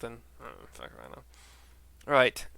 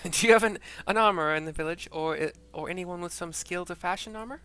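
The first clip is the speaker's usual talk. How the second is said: put-on higher, louder Valley Girl American accent; 6 semitones higher, 9 dB louder than usual. Woman asking about an armorer, Valley Girl accent. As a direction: ask it like a Californian Valley Girl. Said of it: "I try to make her Valley Girl".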